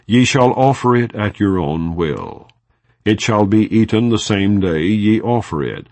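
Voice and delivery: normal talking voice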